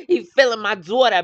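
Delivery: cracking up at her own bit; laughing real, dropping the accent. Real, not acted.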